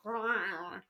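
drawn-out grudging "ugh"; exasperated groan. Retold or imitated. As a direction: groan like you're losing the argument. Prince grudgingly gives in to demand.

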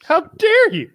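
high-pitched, hammed-up indignation; mock outrage. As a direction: squeak it out as if gravely offended. Mock offence at being put small.

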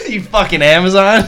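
high, loud, laughing comeback; laughing mock insult. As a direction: hurl a joking jab while cracking up. Mock insult at the taller girl.

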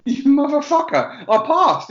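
high, loud, drawn-out triumphant cry; mock exultant yell. Retold outburst of joy at the instructor after passing. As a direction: shout it out like you just passed.

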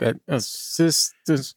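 slow baffled sounding out; confused stumbling. Pretend. Confused reader trying to say asystole, slow. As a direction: stumble over the word like a layman. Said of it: "Everyone else is like".

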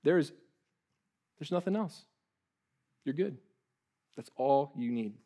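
soft, settling reassurance; tender reassurance. Real, not acted. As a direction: bring them down gently. Tender reassurance after peak.

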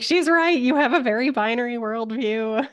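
high-pitched imitation of an indignant heart screaming; mock scream. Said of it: "Birdie's heart screaming at her".

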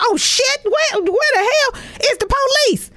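shrieking way up in alarm; sudden high panicked squeal; mock panic. Comedian acting her own panic on seeing police.

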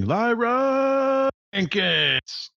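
high, long drawn-out yell of a name; screamed battle cry. Acted. Attacker runs at you from behind, screaming. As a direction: charge in screaming it, every vowel stretched. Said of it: "runs at you screaming".

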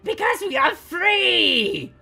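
very high, slow, full shout; exultant shout. A narrator's reading. Crowd replying to Raya's doubt, exultant shout. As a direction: shout it out, stretching the words.

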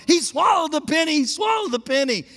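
high, loud alarmed cry; panicked sister. Retold or imitated. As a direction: sound the alarm like a frightened little sister.

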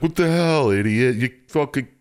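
mildly hammed-up exasperated telling-off; mock exasperated boss. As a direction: act out a mob boss's exasperation. Paulie scolding after accidental killing.

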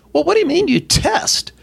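squeaky puzzled question; confused parent. Retold or imitated. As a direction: parent baffled by the new testing.

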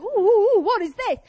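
high, loud mock-dog oohs; excited dog. Dog noticing the sheep, excited and curious. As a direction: voice a curious dog spotting something new. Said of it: "and she's like".

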